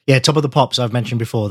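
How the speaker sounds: ordinary talk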